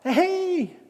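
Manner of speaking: high, slow, stretched-out cry for help; drawn-out high mock yell; retold shouting. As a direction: holler helplessly to the shore, acting it out. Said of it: "Y les gritaba".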